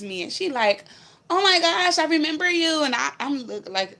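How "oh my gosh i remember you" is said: high, loud gush of recognition; excited recognition. Retold or imitated. Woman in a bar greeting the storyteller, excited high gush. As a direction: mimic her thrilled squeal at seeing you.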